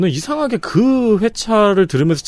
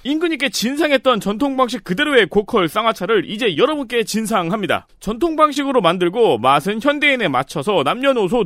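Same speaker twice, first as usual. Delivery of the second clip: higher, upbeat sales pitch; infomercial; 7 semitones higher than usual. Acted. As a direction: pitch it like a home-shopping host. Reads the sponsor ad.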